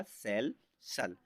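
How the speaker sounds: सेल सल in two parts, "sel", then "sal"; syllable-by-syllable pronunciation. Shown as a demonstration. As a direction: split सेल सल, ending on an S-sound "sal". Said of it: "यहाँ पर उच्चारण निकलेगा".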